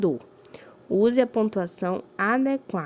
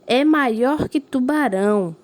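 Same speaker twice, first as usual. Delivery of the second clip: higher and louder, slow amazed reading; surprise; 5 semitones higher, 6 dB louder, slower than usual. Shown as a demonstration. Teacher demonstrating surprise to pupils, amazed. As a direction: show the class how astonishment sounds. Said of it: "Tá indicando uma surpresa".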